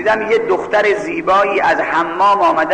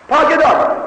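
high-pitched exclamation; emphatic cry; 10 semitones higher than usual. Real, not acted. Preacher telling the crowd what the pure do, emphatic cry. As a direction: throw it out high as a proclamation. Declares that the pure one shouts.

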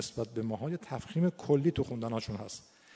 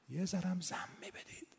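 whisper; 11 dB quieter than usual. Shown as a demonstration. Teacher demonstrating a vowel shade.

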